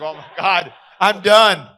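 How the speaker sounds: retold groan of doom, loud and rising; mock-despairing, high and loud; loud dismayed outburst. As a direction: blurt it out loudly, as if all is lost. Retold panicked reaction to veteran.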